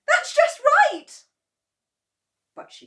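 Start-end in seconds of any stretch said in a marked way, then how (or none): 0.1-1.2 very high, loud cry to make it stop; desperate cry. Acted. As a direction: scream stop at the top of her voice. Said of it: "cried Missus Cranky".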